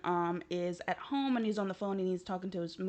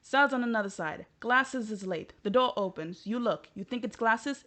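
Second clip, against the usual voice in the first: ordinary talk, nothing marked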